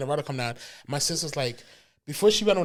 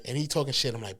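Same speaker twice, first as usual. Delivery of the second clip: usual speaking voice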